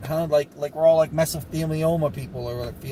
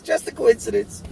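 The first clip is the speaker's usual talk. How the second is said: sarcastic; 7 semitones higher than usual. Acted. To viewers, mocking the coincidence claim: sarcastic higher repeat. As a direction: say it like nobody believes it.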